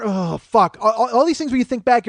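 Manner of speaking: ordinary delivery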